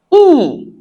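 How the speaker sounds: "E" as a long, high "ee"; French I sound. Shown as a demonstration. Teacher shows how French I sounds, long "ee". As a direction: hold "E" high as a pure "ee". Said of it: "I sounds".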